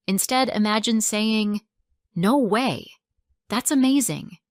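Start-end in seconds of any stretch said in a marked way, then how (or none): 2.2-4.4 amazed reaction with big pitch swoops; alive, real excitement. Shown as a demonstration. Teacher models reacting to a friend's job news, excited. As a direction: react with lively, heartfelt amazement. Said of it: "It feels real".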